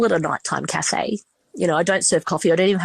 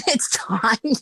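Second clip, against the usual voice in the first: laughing repeat; 3 semitones higher than usual. Real, not acted. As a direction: laugh it off as you repeat "It's time".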